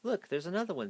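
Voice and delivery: high, eager pointing-out; excited cry. A narrator's reading. Zoe pointing out another flower to Rudolph, excited. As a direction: spot it and call it out with delight. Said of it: "Zoe cried".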